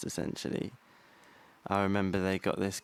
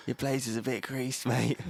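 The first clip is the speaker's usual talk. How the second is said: mock cheeky heckle; 4 semitones higher than usual. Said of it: "someone just shouted out".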